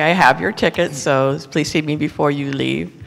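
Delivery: normal talking voice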